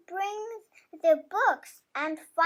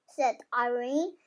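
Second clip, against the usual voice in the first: ordinary delivery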